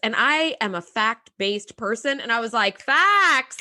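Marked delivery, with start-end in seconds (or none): 2.8-3.6 loud, high "Facts"; snapping approval. Real, not acted. Fan cheering Data's reply, snapping approval. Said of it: "I was snapping".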